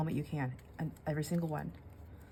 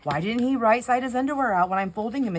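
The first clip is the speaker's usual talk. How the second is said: exasperated thought; 6 semitones higher, 8 dB louder than usual. Retold or imitated. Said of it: "I think".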